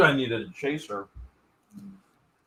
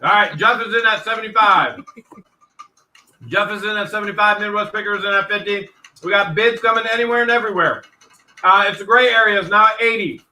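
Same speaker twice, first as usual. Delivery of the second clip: quick, raised auction calling; auctioneer calling bids; 6 semitones higher, 4 dB louder than usual. Real, not acted. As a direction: call out the bids like a live auction.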